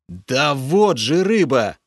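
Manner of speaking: loud, slow exasperated outburst; losing patience. A narrator's reading. Hero loses patience with Lokhar, loud and exasperated. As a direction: finally snap at him. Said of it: "Уже не выдержал я".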